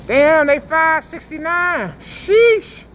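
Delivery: high, slow surprised sheesh; price shock. Real, not acted. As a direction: react to a steep price.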